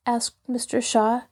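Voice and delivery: usual speaking voice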